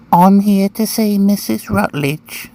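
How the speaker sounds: put-on little boy's high-pitched English accent; prim, high English accent of a small boy; funny English accent. A narrator's reading. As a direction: pipe up as a very proper English child. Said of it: "spoke with a funny English accent".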